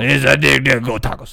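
loud Cajun coach impression; Ed Orgeron. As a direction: bellow it like a Cajun football coach. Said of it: "say it like Ed Orgeron".

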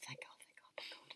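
breathless, whispered thanks; relieved whisper. Retold or imitated. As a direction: let the relief out under your breath. Relieved grandma at the table.

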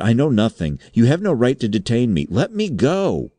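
high, panicky protest climbing in pitch; frightened denial. A narrator's reading. Undersecretary protesting to his captor, frightened and climbing. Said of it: "stammered out an incoherent denial".

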